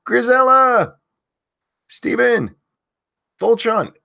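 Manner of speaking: loud, high name call-outs; yelling names. Real, not acted. Host yelling chat names to early viewers, loud and high. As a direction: belt each viewer's name out. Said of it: "if you want me to yell your name".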